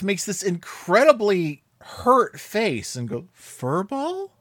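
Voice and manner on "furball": wounded, high-pitched disbelief, rising at the end; crushed. Retold or imitated. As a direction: imitate a hurt cartoon cat questioning an insult. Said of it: "He just sounds absolutely crushed".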